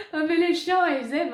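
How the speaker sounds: ordinary delivery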